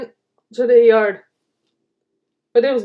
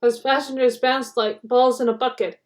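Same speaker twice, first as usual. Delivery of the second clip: usual speaking voice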